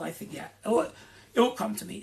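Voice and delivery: ordinary talk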